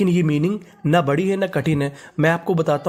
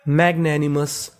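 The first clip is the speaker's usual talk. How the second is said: slow syllable-by-syllable मैग्नैनिमस; slow model reading; slow demo word; 3 semitones lower, slower than usual. Shown as a demonstration. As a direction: draw out मैग्नैनिमस as mag-na-ni-mus. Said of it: "तो पूरी meaning".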